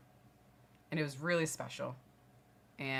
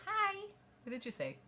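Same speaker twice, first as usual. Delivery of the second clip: put-on child on phone. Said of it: "Thing two picks up the phone".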